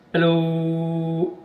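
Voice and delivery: drawn-out hello into the mic; testing the CB, calling hello; radio mic check. Real, not acted. Keying the radio mic, calling hello.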